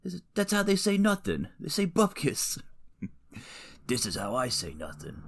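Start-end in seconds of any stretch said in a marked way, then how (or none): 0.3-2.6 high-pitched bad gangster voice; badly faked tough guy, pitched way up; terrible mobster impression. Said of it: "Those are terrible impressions". 3.9-5.2 badly faked gangster threat; terrible impression. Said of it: "Those are terrible impressions".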